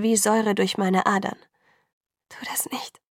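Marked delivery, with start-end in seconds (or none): 2.3-3.0 hushed, whispered plea; soft voiceless pleading. A narrator's reading. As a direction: beg in a whisper, voice nearly gone. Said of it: "Meine Stimme war nur noch ein Flüstern".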